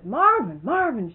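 high, loud mock-urgent holler; calling out. Miss Mary jumps up and hollers for Marvin, calling out. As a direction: holler his name as if raising the alarm.

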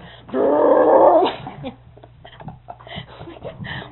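loud mock rumbling crash; imitated crash noise. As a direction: make the sound of a wagon crashing. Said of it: "all of a sudden you hear this".

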